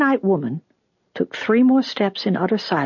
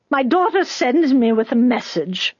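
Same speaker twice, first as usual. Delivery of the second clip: put-on high, urgent old woman's voice; pleading old woman; 7 semitones higher than usual. A narrator's reading. Old mother bringing her daughter's message to Judah, high pleading.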